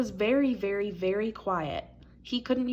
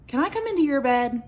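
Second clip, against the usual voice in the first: high, louder little mouse asking; little mouse begging to share the bed; little mouse pleading; 7 semitones higher, 7 dB louder than usual. A narrator's reading. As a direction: ask sweetly like a tiny mouse. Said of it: "asked Little Mouse".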